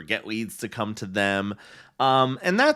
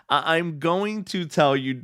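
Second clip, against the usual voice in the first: ordinary talk, nothing marked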